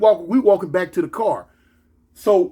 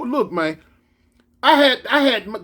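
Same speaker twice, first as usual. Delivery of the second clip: normal talking voice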